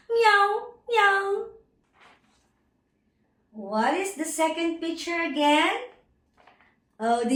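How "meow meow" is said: high-pitched imitated cat meow; teacher's high cat call. Shown as a demonstration. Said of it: "The cat says".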